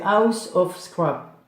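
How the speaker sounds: slow, deliberate English accent on the channel name. Shown as a demonstration. Vlogger naming a fan's channel in an English accent. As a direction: say House of Scrap the English way, drawn out. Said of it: "pourquoi j'ai mis un accent anglais?".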